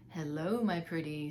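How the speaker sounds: lightly voiced sneaky fox; sly fox. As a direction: purr it like a cunning fox. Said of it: "said the fox".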